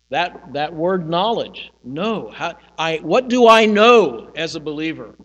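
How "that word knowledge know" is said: usual speaking voice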